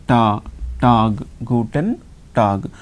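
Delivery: ta, tag with open "ah", then guten tag; slow teacher demo of guten tag, "goo-ten tahg"; German pronunciation. Shown as a demonstration. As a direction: slowly build ta into tag, "tahg". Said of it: "A is A sound".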